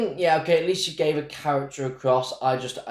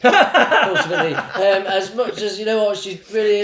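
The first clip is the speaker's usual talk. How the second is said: laughing; 7 semitones higher, 6 dB louder, slower than usual. Real, not acted. Laughing through grudging praise.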